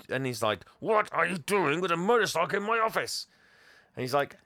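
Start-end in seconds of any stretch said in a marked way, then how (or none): 0.8-3.3 high, mock-indignant demand; hammed-up villain outrage; outraged boss. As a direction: act out an appalled boss, pitched up.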